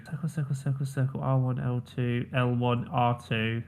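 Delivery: softly running through it from memory; quiet, under-the-breath recall; reciting to oneself. Real, not acted. Player recalling a button code for the host, quietly to self.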